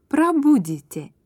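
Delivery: normal talking voice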